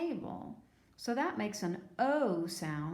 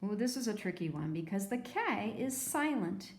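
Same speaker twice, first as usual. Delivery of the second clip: ordinary delivery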